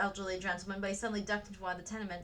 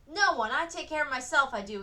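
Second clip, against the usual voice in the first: put-on defiant boy; 5 semitones higher, 7 dB louder than usual. A narrator's reading.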